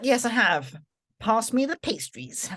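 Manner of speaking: playfully defiant, drawing out the request; cheeky comeback. Acted. Defiant comeback to mum, joking.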